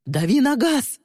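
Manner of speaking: urgent, high-pitched yell; shouted order. A narrator's reading. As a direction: yell it at the driver. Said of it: "выкрикнул Тристин". (резкий выкрик)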